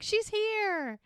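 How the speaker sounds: very high, drawn-out mock cheer; celebrating friends. Sewing circle greeting the bride as she arrives, excited cheer. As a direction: squeal it like welcoming friends. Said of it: "And they're like".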